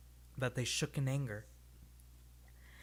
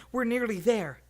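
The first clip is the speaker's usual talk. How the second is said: loud, higher encouragement; urging on; 9 semitones higher, 9 dB louder than usual. A narrator's reading. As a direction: spur him on up the hill. Urging the companion up the hill.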